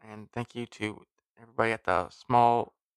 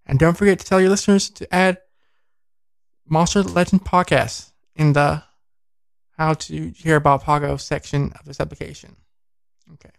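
bright, louder sponsor read; 3 semitones higher, 6 dB louder than usual. Acted. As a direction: sell it like a radio spot.

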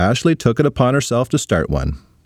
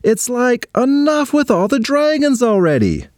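hollering; 13 semitones higher than usual. Acted. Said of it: "Ashley hollered".

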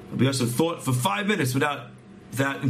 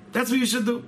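much higher, pressing it home; insistent repeat; 10 semitones higher than usual. Retold or imitated. Rabbi urging the patient to have faith, insistent. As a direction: raise the voice's pitch to urge it.

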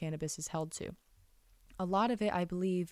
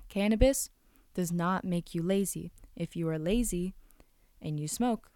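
usual speaking voice

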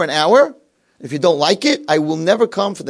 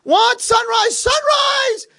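very high, loud cries; excited shouting; 6 dB louder, much slower than usual. Acted. Lefkowitz announces daybreak to sleepy roommate, excited shouting. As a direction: trumpet the news to rouse him. Eager roommate keeps waking him.

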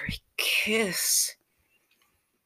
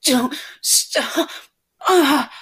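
loud, high, pleading cries; impassioned, pleading outcry; screaming with pleasure; 8 semitones higher, 9 dB louder than usual. Acted. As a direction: cry out high and loud, begging between moans. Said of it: "but now screaming with pleasure".

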